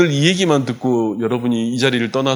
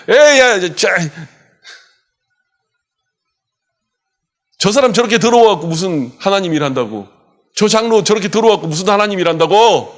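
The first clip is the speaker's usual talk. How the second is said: high, loud jeering devil; devil's taunt; 6 semitones higher, 3 dB louder than usual. Retold or imitated. Devil accusing the elder before God, high and jeering. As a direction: taunt the elder with scorn, pitched up. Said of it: "마귀가 놀리는 거예요".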